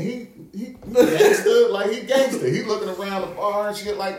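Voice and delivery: high, laughing emphasis on gangster; laughing, slowed and high. Real, not acted. Laughing while describing cousin.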